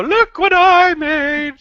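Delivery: high, slow show-off voice; mock proud. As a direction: proudly present a homemade craft. Mock proud crafter on video.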